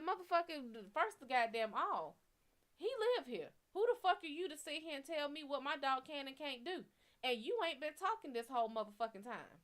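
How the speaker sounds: put-on retelling her outraged rant at the boyfriend; furious rant. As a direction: rip into him, outraged.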